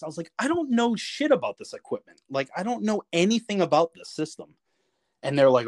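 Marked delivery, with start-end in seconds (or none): 0.4-4.5 high-pitched retold complaint; exasperated protest. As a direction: plead ignorance indignantly, starting high. Protests being ordered to fix it.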